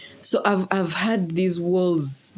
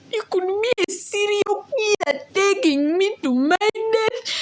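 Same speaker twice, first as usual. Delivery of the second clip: hammed-up squealed accusation; mock panic, voice shooting up; high-pitched protest. Acted fearful protest to driver.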